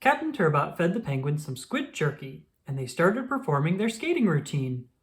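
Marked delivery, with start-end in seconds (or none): none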